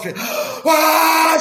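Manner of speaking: gasp, then a slow, very high cry; big cry. Retold or imitated. Swimmer crying out to the boat with the torch, very high and drawn out. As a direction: scream into the night. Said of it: "Je pousse un grand cri".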